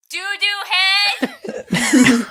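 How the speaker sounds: high, slow, drawn-out taunt; childish taunt. Acted. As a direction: stretch it out like a bratty kid. Stitch taunting over intercom.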